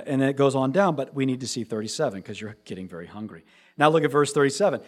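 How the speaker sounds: ordinary talk, nothing marked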